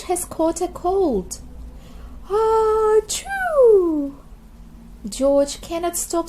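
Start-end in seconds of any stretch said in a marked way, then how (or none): 2.3-4.1 loud, high acted sneeze; loud cartoon sneeze; big sneeze. A narrator's reading. George cannot stop sneezing.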